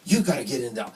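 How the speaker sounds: lightly voiced New Jersey nurse giving orders. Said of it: "this New Jersey nurse in Princeton".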